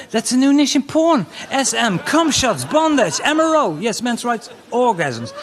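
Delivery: high, loud giddy musing; mock excited thought. Comic voicing his own giddy thought, high and loud. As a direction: gush over the idea. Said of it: "I thought".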